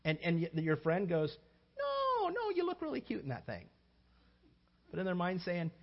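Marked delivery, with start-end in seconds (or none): 1.8-3.7 very high, sweet no; fake reassurance. Friend reassuring about an outfit in a high coo.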